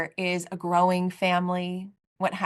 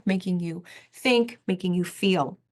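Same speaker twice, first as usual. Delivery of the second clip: usual speaking voice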